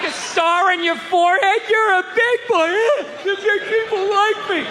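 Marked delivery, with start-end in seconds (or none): 1.7-4.7 mock praise to a child. Condescending church praise voiced.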